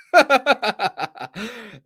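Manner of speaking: a full real laugh at the rivalry; hearty laugh. Real, not acted.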